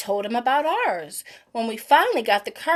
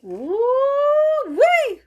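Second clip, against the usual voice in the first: high, loud woo-wee; delighted; 13 semitones higher, 5 dB louder than usual. Real, not acted. Reader sips tea and exclaims happily. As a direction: savour the tea with glee.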